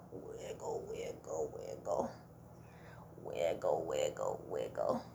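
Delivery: very low, soft wiggle chant; worm voice. Shown as a demonstration. Teacher doing a silly worm voice for kids. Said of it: "Can you wiggle like a worm?".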